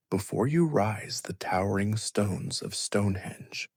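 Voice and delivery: usual speaking voice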